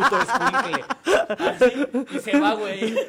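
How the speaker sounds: words broken by real laughter; cracking up mid-story; laughing. Real, not acted. Laughing hard at the milk prank story.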